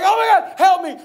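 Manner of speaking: high, loud cry for help; acted alarm. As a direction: play a drowning man calling out. Peter starting to drown.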